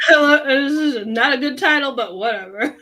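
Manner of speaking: laughing mimicry. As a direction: laugh through a teasing echo. Mimicking host's title remark laughing.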